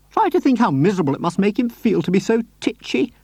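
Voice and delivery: put-on high, sweet old lady voice; Mrs Silver voice. A narrator's reading. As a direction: lift into a gentle, doting lady.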